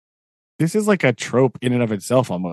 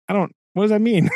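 quick, higher baffled question; confused; 8 semitones higher, faster than usual. Retold or imitated. Viewer confused by the bird call, quick higher puzzled. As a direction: rush it out, puzzled. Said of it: "You're like".

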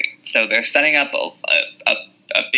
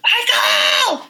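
high shrill-free mock yell of a name, much higher; imitated yell. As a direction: yell the name like an angry mother. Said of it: "when she's yelling at Michael".

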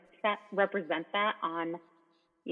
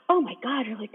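higher, louder gasp of amazement; awed excitement; 6 semitones higher, 6 dB louder than usual. Retold or imitated. Viewer reacting to a show they just watched, awed excitement. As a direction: gush as if blown away. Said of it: "you watch this and you're like".

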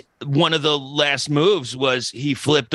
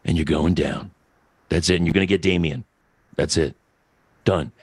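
much lower, calm, unhurried menace; calm Jake the Snake; 9 semitones lower, faster than usual. Retold or imitated. Host imitating Jake the Snake's calm promo to co-hosts, low and steady. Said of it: "he didn't yell".

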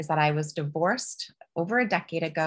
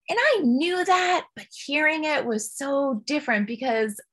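usual speaking voice

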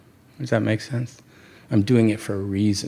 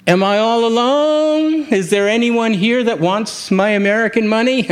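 louder impatient New Yorker calling out in an empty shop; rather loud; 10 semitones higher, 4 dB louder than usual. Retold or imitated. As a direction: call into the empty shop, demanding service. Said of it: "I said rather loudly".

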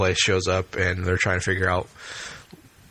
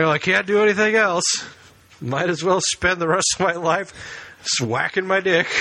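dead man voice; 10 semitones higher than usual. Retold or imitated.

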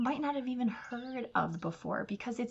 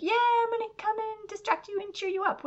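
bubbly friend; 14 semitones higher than usual. Retold or imitated. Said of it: "a feel-good friend who's like".

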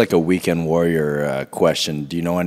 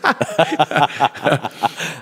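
high laugh; 9 semitones higher than usual. Real, not acted. Laughing about Borat comparison.

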